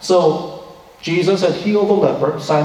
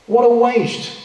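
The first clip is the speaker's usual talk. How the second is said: disapproving; 6 semitones higher, slower than usual. Retold or imitated. Imagined onlooker disapproving.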